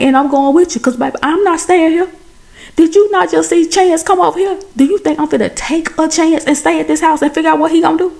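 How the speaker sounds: frantic, refusing to stay behind; panicked and high. Retold or imitated. Her own panicked reply in story.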